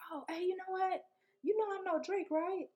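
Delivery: high, casual bragging voice; boastful guy. Retold or imitated. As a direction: drop a big name like it's nothing. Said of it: "Then he like".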